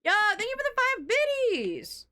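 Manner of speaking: high, silly put-on thank-you; squeaky goofy voice thanking. Thanking a viewer twice.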